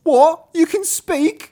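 very high, loud, slow disbelief; astonished maid. Acted. Maid discovering the horse can speak, loud astonishment. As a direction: shriek in hammed-up amazement.

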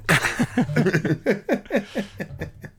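gleeful high cackle; high laugh. Real, not acted. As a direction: cackle with glee. Laughs after gloating.

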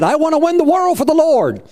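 eager quoted vow. Retold or imitated. Preacher quoting a zealous man, pitched high and eager.

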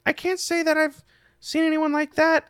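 put-on high-pitched, hesitant kid voice; kid character. Game master voices a kid.

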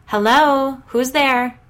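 high, loud frightened call; screamed. A narrator's reading. Callie calling out at noises in the house, loud scream. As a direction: scream it into the dark hallway. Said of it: "Then she screamed".

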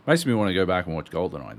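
ordinary talk

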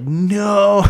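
high, loud mock refusal; flat refusal. To his wife asking him to apologize, a loud mock no. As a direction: blurt a stubborn no. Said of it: "I was like".

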